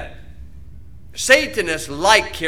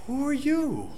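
mock stunned who are you, pitched up; baffled reaction; 7 semitones higher, 9 dB quieter, slower than usual. Churchgoer staring at the preacher, mock baffled. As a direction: play the shocked listener.

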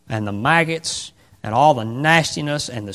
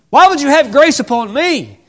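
much higher, louder cry of disbelief; rising plea; 11 semitones higher, 8 dB louder than usual. Acted. Ruth pleading to Boaz, much higher cry. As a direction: cry it out in wonder.

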